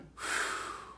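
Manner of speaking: audible unvoiced exhale; soft demonstration breath out; breath demo. Shown as a demonstration. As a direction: let the air out softly. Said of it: "when I breathe in".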